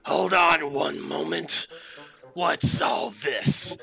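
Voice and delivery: loud, drawn-out boss voice; devil boss. Acted. Devil boss answers his guard from the back, calling out. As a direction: call it out from the back. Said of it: "you hear from the back".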